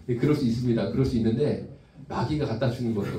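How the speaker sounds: normal talking voice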